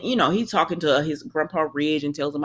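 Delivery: ordinary delivery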